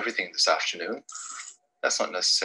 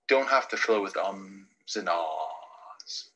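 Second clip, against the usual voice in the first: slow and quiet; 3 semitones lower, 4 dB quieter, slower than usual. Shown as a demonstration. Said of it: "nothing wrong with a bit of silence".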